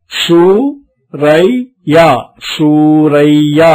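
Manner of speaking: stretched out, word drawn out very slowly; slow teaching demo, every sound stretched; syllable-by-syllable model reading. Shown as a demonstration. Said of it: "గట్టిగా పలకాలి".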